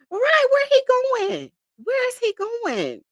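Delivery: high, amused disbelief; mock his panicked escape, laughing high. Real, not acted. Streamer mocks the fleeing defendant to chat, high and amused.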